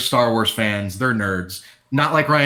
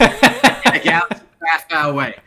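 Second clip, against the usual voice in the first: high, loud laughing quote; 3 semitones higher, 7 dB louder than usual. Real, not acted. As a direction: laugh through the line. Laughing Star Wars line.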